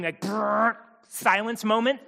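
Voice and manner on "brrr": imitated record-scratch noise; rolled brrr like a scratched record; vocal record screech. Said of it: "record scratching".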